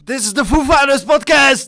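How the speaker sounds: loud, high shout. Real, not acted. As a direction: shout the sign-off. Shouted sign-off.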